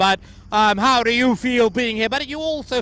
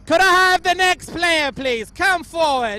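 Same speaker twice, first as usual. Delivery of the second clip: higher and louder, summoning across the room; calling out; 9 semitones higher, 6 dB louder, slower than usual. Real, not acted. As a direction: call the next player up. Summoning the next player loudly.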